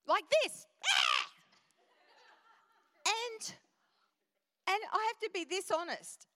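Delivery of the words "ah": high, loud imitated screech; short piercing mock shriek. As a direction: screech it out right in someone's face. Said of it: "like, screech in my face".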